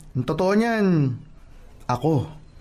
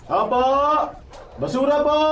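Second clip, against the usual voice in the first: calling out; 12 semitones higher than usual. Acted. Garbage collector calling from outside.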